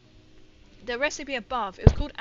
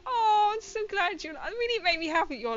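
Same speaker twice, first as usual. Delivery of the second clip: high, loud, near tears with joy; touched and thrilled; 11 semitones higher, 11 dB louder than usual. Real, not acted. Thanking a viewer whose wife liked her top, overjoyed. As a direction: gush with happy emotion.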